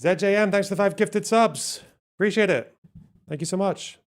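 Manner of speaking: high, loud excited thanks; bright streamer thank-you shout. Real, not acted. Thanks chat for subs.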